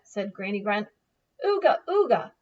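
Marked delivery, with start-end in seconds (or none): 1.4-2.3 high, loud caveman call; caveman chief call. Acted. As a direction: call the tribe to bed. Chief calls bedtime.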